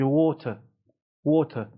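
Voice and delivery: "water" as waw-tuh with silent r; British pronunciation. Shown as a demonstration. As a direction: say "water" British, rounded vowel and true t.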